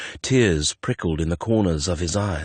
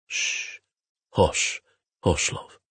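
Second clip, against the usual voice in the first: tender hushing; slower than usual. A narrator's reading. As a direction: hush a frightened loved one gently. Soothing a frightened partner.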